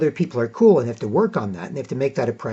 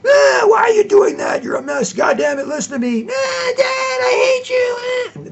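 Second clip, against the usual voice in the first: high, shouting hysterical yell rising to a shriek; mock screaming fight; 4 dB louder than usual. Parent and child screaming at each other. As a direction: scream it like a meltdown.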